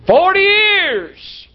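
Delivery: "Forty years!" high and slowly drawn out in disbelief; shocked exclamation. Retold or imitated. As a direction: gasp it out, stunned. Shocked at forty-year divorce.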